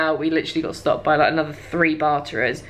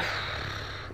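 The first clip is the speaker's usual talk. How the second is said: long soft sigh; quiet exasperated exhale; weary sigh; 13 dB quieter than usual. Retold or imitated. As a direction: breathe out in mock despair. Retelling their reaction to the sales pitch.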